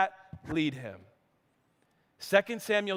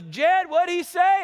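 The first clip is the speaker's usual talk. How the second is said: son asking; 8 semitones higher, 4 dB louder, slower than usual. Retold or imitated. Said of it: "And he goes".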